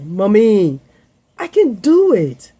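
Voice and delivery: imitating a daughter's loud, high protest; mock child's indignant insistence, drawn up high; insistent child. Acting daughter insisting on doing it.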